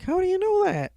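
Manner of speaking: put-on high-pitched questioning kid; child's voice. Jamie questioning Turbo Man, high child voice. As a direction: pitch it way up like a little boy.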